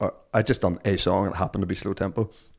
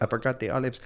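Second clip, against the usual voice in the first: bad Spanish accent; 4 semitones higher, 5 dB quieter than usual. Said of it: "It was, like, terrible".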